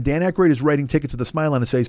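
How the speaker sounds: usual speaking voice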